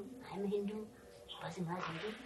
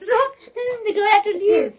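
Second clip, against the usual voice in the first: laughing; 9 semitones higher, 16 dB louder than usual. Real, not acted. Laughing through the story.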